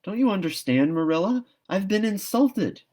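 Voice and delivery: high, loud wounded protest; indignant. Acted. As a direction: plead a grave insult. Anne indignant to Marilla.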